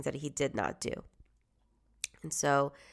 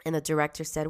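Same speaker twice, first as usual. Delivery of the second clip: ordinary delivery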